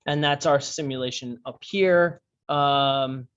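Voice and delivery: ordinary talk, nothing marked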